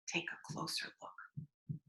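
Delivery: breathy whisper to the listener; whispered aside. Real, not acted. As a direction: lean in and whisper the invitation.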